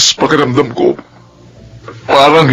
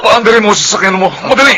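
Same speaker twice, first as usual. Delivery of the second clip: urgent command; 6 semitones higher, faster than usual. Acted. Father urging to start the car.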